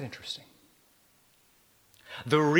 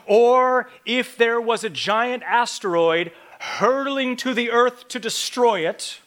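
usual speaking voice

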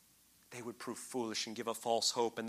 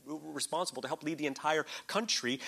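ordinary talk, nothing marked

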